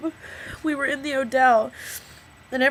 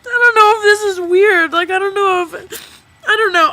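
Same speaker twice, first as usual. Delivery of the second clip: teary; 9 semitones higher, 11 dB louder than usual. Real, not acted. Tearful start of confession.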